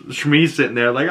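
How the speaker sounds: ordinary delivery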